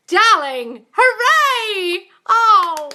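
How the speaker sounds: high, loud whoop of congratulation; real delighted cheering. Real, not acted. Coach celebrating her client's news, delighted.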